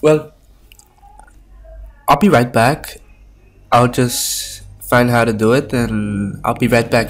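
usual speaking voice

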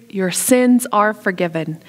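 usual speaking voice

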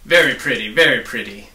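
loud, sarcastic scowl; scowling officer mocking the mess. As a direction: sneer it out loudly. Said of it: "he scowled".

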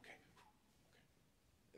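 whispered prep before going in; soft whispered muttering to self; hushed self-talk. Retold or imitated. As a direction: whisper it to yourself at the door. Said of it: "I would go".

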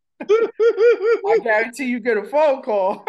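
slow, drawn out through real laughter; words riding on laughter; laughing tease. Real, not acted. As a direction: tease while cracking up. Teasing amid group laughter.

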